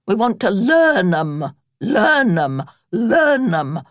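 insistent repetition, each learn 'em pressed harder; a touch of a stubborn Badger. A narrator's reading. Badger insisting to Rat, mildly stubborn repetition. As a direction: dig in and hammer the phrase.

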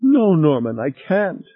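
crying. A narrator's reading. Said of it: "she began crying again".

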